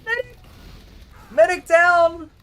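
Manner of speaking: loud, high shout of "Medic down!"; man down shout. Real, not acted. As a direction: yell it out like a hype caster. Caster calls out the medic kill.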